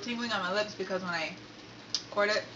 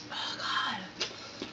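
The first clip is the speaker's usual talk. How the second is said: whispering; 5 dB quieter than usual. Real, not acted. Burning mouth after spicy food.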